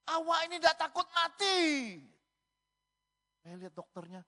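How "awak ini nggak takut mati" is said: very high, loud challenge; imitated doctor. Doctor confronting his patient about death, loud and high-pitched. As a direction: mimic a doctor yelling at a patient. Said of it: "Dokternya ngomong gini".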